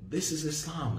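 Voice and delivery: softly put, no preaching; understated statement. Retold or imitated. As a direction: state it simply and quietly. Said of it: "He was just saying".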